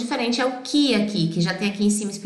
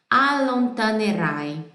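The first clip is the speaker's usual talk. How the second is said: ordinary delivery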